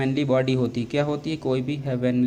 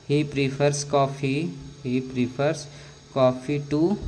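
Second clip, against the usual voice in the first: slow; much slower than usual. Shown as a demonstration. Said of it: "है ना".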